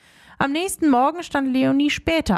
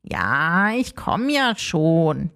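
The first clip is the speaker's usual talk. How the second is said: sleepy; 7 semitones lower, much slower than usual. Acted. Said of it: "Der kleine Geist gähnte".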